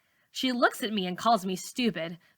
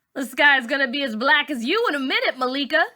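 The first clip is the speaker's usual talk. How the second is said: taunt; 6 semitones higher, 5 dB louder than usual. A narrator's reading. Boy taunting girl.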